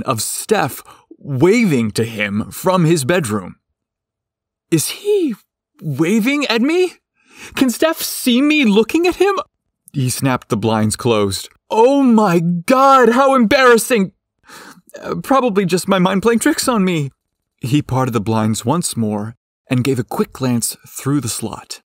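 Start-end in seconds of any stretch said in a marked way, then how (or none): 4.7-9.4 high, startled inner voice; panicked disbelief. A narrator's reading. Bryce panics, caught looking. 11.7-17.1 high, loud mortified cry; flustered panic. A narrator's reading. Bryce embarrassed, caught peeping.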